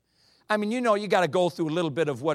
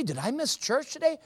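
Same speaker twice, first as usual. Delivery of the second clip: high, startled question; a sudden high-pitched alarm; shocked bride; 11 semitones higher than usual. Retold or imitated. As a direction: gasp out the realization.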